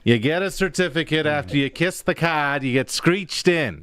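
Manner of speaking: put-on a rough, half-hearted Newfoundland accent; playful put-on Newfoundland accent; bad Newfoundland accent. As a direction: toss on a terrible Newfoundland accent for fun. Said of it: "That was a terrible Newfoundland accent".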